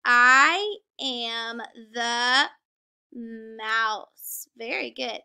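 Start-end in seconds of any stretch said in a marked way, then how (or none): 0.0-2.5 loud, very drawn-out words, one by one; reading together slowly. Shown as a demonstration. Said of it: "So let's read that together". 3.1-4.5 "mouse" stretched out with a long opening M; reading together slowly. Shown as a demonstration. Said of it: "So let's read that together".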